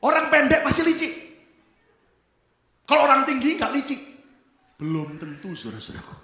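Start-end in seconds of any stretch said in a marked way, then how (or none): 0.0-4.0 loud, high mock certainty; mocking proclamation. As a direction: boom out the prejudice to ridicule it. Preacher mocking the assumption.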